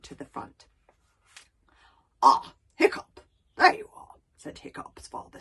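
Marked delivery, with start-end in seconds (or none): 2.2-4.1 a loud, offhand Stoic; booming but distracted greeting; absent-minded chief. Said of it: "absent-mindedly".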